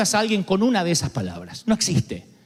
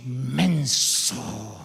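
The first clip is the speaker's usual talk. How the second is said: hateful insult; 8 semitones lower, 4 dB quieter than usual. Shown as a demonstration. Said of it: "con un odio".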